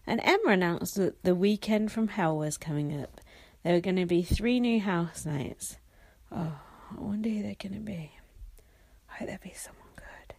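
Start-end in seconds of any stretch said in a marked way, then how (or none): 6.3-10.3 very soft breath-only whisper; playful whispered wish; hushed wondering. Pretend. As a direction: whisper it as if secretly hoping. Hushed wondering about new housemates.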